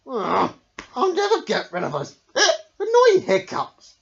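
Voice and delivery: high, loud whiny wail; grumpy wail. A narrator's reading. Smiley Shark giving up on his hiccups, wailing. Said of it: "he wailed".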